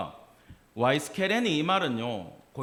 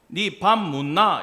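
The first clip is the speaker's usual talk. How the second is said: slow, louder Busan-accented question; Busan dialect; 2 semitones higher, 6 dB louder, slower than usual. Shown as a demonstration. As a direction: demonstrate the Busan tune, punched and drawn out. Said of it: "부산 가면 뭐가 되는지 아세요".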